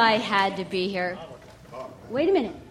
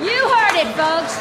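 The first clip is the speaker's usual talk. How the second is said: excited reporter; 6 semitones higher than usual. Acted. Reporter punches line.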